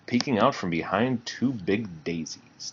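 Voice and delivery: ordinary delivery, nothing marked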